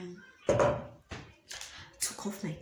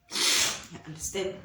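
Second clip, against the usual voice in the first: ordinary talk, nothing marked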